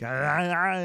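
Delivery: loud, high mock-German cries; mock German yelling. Co-host teasing the host's accent, loud mock German yelling. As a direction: yell nonsense cries as a caricature of German. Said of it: "I thought German was more like".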